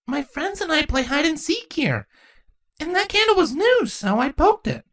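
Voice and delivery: put-on high, innocent child; chatty little kid explaining; little girl voice. A narrator's reading.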